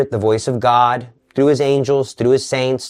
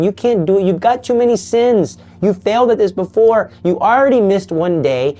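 higher, mocking accusations; taunting devil; 7 semitones higher than usual. The devil taunting a struggling soul, high and mocking. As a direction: needle the listener like a sneering tempter.

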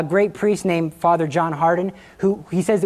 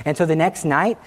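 normal talking voice

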